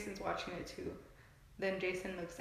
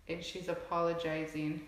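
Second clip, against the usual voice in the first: ordinary talk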